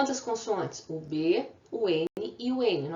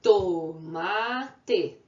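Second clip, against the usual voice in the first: "Tomate" slowly split into to, ma, te; teacher demonstrating the three beats of "Tomate"; syllable by syllable. Shown as a demonstration. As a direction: draw out and separate each syllable of "Tomate". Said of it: "a gente já fez aqui a separação".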